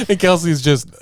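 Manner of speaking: ordinary talk, nothing marked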